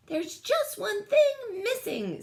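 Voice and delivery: high, slow musing alien; squeaky hint of a puzzle; thoughtful alien. A narrator's reading. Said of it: "said Bork".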